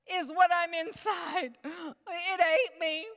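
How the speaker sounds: high, loud gasping panic, slowing to a cry; terrified Jonah. Acted. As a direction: act out Jonah horrified inside the monster. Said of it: "and he's thinking".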